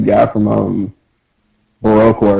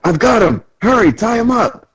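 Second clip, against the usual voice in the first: high, hurried townsperson; high-pitched mock panic; urgent call; 13 semitones higher than usual. Acted townsperson calling for help.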